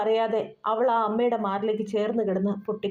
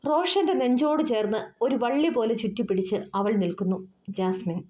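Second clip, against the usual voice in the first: ordinary delivery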